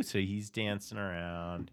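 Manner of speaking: ordinary delivery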